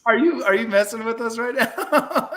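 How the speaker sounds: high-pitched words through laughter; asking while cracking up; laughing. Real, not acted. Cracking up at the helium voice.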